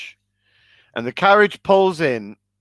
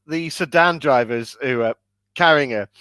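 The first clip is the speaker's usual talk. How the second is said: ordinary delivery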